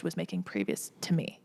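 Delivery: a real whisper, voice dropped to breath; whispered aside. Real, not acted. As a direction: let the figure slip out as a secret aside. Secret salary detail aside.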